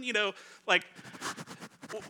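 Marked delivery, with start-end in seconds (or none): none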